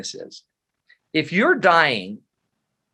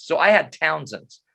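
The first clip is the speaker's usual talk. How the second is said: normal talking voice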